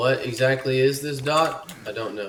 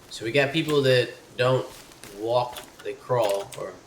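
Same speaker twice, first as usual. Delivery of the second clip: ordinary talk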